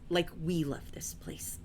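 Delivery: soft, slowed near-whisper; hushed intimacy. Acted. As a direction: let it drop to a slow whisper. Voicing the family's attachment.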